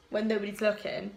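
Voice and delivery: normal talking voice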